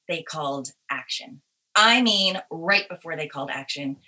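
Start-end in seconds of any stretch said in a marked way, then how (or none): none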